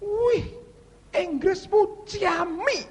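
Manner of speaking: very high, drawn-out cry of admiration; teriakan kagum. Retold or imitated. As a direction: imitate an amazed listener squealing slowly. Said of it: "Terus dia teriak". (teriakan kagum melengking)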